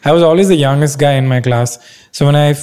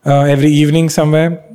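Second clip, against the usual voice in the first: ordinary talk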